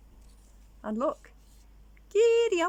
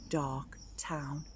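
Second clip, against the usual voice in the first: soft whispered ending; spooky whisper; 3 semitones lower, 7 dB quieter than usual. A narrator's reading. Reader ending the story to child, spooky whisper. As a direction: hush it to a secret.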